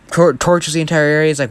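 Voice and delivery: ordinary delivery, nothing marked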